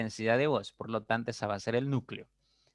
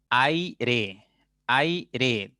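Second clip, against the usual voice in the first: "aire" in two deliberate beats, ai, re; syllable by syllable. Shown as a demonstration. Teacher presenting an example, "aire" split into two syllables. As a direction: hold ai together, then re, in "aire". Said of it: "Por ejemplo, tengo".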